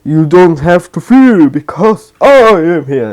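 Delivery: All Might voice. Retold or imitated. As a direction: boom it out like the big hero. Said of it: "All Might appears and says".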